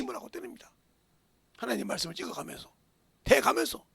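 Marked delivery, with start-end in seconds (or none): none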